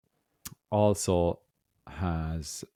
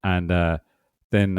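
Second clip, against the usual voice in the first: usual speaking voice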